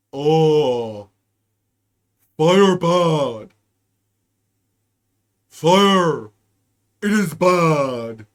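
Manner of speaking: low, loud, slow caveman grunt of fire bad; caveman parody. Mocking liberals, a low loud dumb caveman voice. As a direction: play a dim caveman, slow and booming. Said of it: "I'll say it like a liberal".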